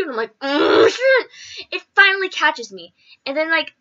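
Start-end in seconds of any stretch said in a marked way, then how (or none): 0.4-1.7 much higher, a squealed curse of panic; hammed-up fright, high; mock panic. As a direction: yelp it as you're caught. Caught by the polar bear.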